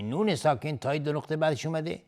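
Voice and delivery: ordinary delivery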